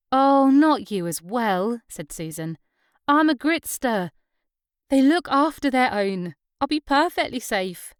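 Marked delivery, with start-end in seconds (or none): 0.1-1.8 slow, drawn-out weary protest; exasperated. Acted. Susan brushing off Clara's worry about the dark, exasperated. 3.1-7.9 put-on higher, louder, proud local girl; proud Susan. A narrator's reading. Susan reassuring Clara about her neighbourhood, proud and higher.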